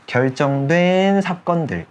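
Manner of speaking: demonstrated reading. Shown as a demonstration. Teacher shows students how to read the phrase, slow demonstrated reading. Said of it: "이렇게 읽으셔야 된다고".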